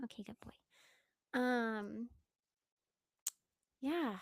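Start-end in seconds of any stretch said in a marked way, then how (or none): 0.0-1.4 whispering. Real, not acted. Whispering to the dog.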